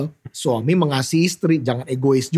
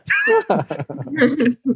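higher burst of real laughter; 8 semitones higher than usual. Real, not acted. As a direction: laugh at own joke. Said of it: "laugh after self-joke".

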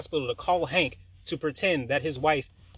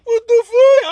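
high, loud, shrieking disbelief; shocked yell; 18 dB louder, slower than usual. Retold or imitated. Reacting to her lie about his mom: high, loud, shocked shriek. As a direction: screech it out in pure shock.

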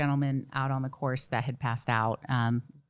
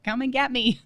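high, louder mock call for rescue; retold yell for help; 10 semitones higher, 5 dB louder than usual. Stuck runner yells out for someone to fetch her, retold yell. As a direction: call out amused, acting your own helpless yell. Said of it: "I just yelled out".

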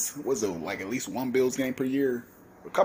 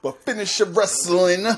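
emphatic correction; 7 semitones higher, 6 dB louder than usual. Shown as a demonstration. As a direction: Finish Your Wrestling said higher and louder, proudly correcting. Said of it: "I don't know why I always say".

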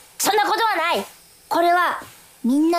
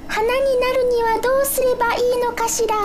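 put-on dreamy girlish wonder; 10 semitones higher, faster than usual. Flower-like dreamy musing voice.

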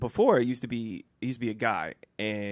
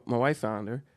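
ordinary talk

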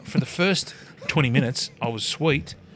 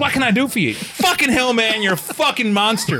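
higher, louder mock-awed outburst; amazed exclamation; 10 semitones higher, 4 dB louder than usual. Shop fella marvelling at the size of a customer, amazed. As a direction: gush in loud, high-pitched amazement.

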